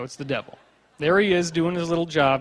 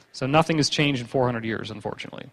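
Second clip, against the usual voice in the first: ordinary talk, nothing marked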